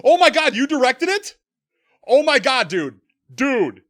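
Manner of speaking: loud, high starstruck exclamation; real shouting excitement; amazed outburst. Real, not acted. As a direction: blurt it out in stunned delight. Amazed outburst at the film's director.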